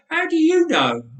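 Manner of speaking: put-on high, loud indignant boy; boy's challenge. A narrator's reading. Nathan challenging his aunt, high and loud. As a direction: fire the question back at her.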